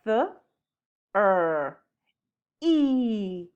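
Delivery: "Th", "er", "i" said as separate sounds; sounding out letters. Shown as a demonstration. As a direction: sound out "Th" "er" "i" one by one. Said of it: "solo tiene tres sonidos".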